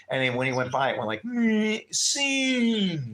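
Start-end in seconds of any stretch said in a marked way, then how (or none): 1.2-3.1 high shout fading as it flies past; passing by. Retold or imitated. Driver speeding past the biker, shout fading off. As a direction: sound like a voice zooming away. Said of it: "screaming it as he was going by".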